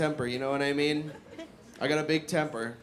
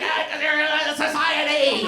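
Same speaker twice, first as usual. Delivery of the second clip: mock yell; 10 semitones higher, 5 dB louder, slower than usual. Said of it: "I was like".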